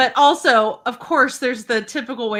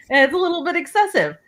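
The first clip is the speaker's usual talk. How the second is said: wry admission; 8 semitones higher, 4 dB louder, faster than usual. Real, not acted. Self-mocking admission.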